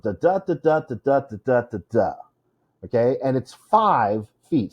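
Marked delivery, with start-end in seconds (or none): 0.0-2.1 weak-strong "da DA" beat, steadily stepping down; iambic rhythm. Shown as a demonstration. Teacher demonstrating the meter, chanted da-DA beat. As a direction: chant each second "da" stronger. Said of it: "So we have this rhythm".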